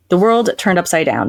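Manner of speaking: ordinary talk, nothing marked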